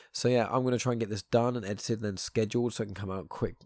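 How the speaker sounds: ordinary delivery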